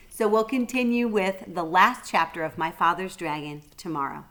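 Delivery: ordinary delivery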